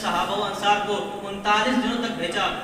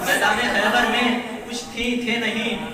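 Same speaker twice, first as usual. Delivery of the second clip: loud, impassioned sermon climax; full-force preaching; 3 semitones higher, 9 dB louder than usual. Real, not acted. Preacher telling the crowd of Khyber, full-force preaching. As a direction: drive each point home loudly to the crowd.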